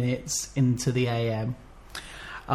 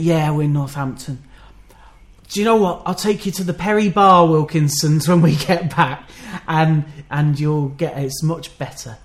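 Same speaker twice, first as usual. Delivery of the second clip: put-on mother's lines in a light Birmingham accent, a bit louder; vague Birmingham accent; 3 semitones higher, 5 dB louder than usual. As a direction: mimic a Birmingham mum promising a better shop. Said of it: "I don't know what my accent is".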